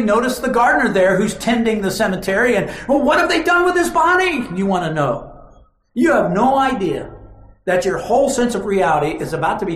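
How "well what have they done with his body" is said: higher, anxious and pleading; distraught question. Acted. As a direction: ask the gardener in desperate worry. Mary asking the gardener.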